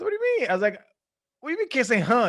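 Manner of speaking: high, loud mock incredulity; disbelief. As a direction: act stunned by a ridiculous rule. His stunned reply to the coach.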